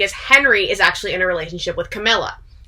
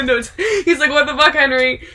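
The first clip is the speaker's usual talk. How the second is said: laughing; 6 semitones higher than usual. Real, not acted. Laughing while retelling a quote.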